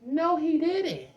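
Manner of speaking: high and slow, outraged disbelief; disbelieving no he didn't. Retold or imitated. Telling friends about the slammed door, high, slow disbelief. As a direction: stretch it high like you can't believe it. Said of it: "I'm like".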